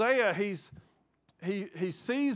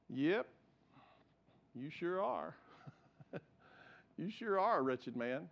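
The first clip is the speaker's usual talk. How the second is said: much softer, lower, gentle reassurance; tender voice of God; 5 semitones lower, 9 dB quieter than usual. Retold or imitated. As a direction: speak as a loving father, hushed. The Lord speaking to his heart.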